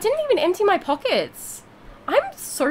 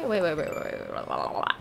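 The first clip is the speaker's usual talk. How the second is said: much lower and softer, trailing away; fading waits; 11 semitones lower, 8 dB quieter, faster than usual. Real, not acted. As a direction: let each wait sink and fade. Catches herself, trails off.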